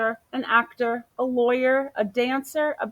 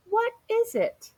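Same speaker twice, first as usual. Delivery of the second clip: much higher curious voice; police officer; 12 semitones higher than usual. Acted. Police officer asking Amu about the smell, high voice. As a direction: ask with eager curiosity.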